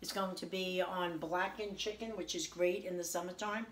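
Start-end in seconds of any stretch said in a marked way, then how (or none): none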